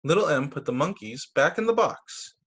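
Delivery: plain reading voice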